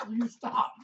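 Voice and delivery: ordinary talk, nothing marked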